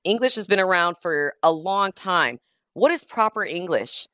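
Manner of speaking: usual speaking voice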